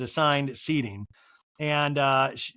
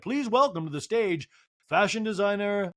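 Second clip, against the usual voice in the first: raised, measured announcer voice; 5 semitones higher than usual. Retold or imitated. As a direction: announce it higher, like a stage host. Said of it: "And the M C's like".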